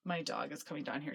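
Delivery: ordinary delivery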